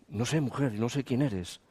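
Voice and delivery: low, soft, nervous denial; hushed denial. Retold or imitated. Peter denying to the woman, low and hushed. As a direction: deny it under your breath.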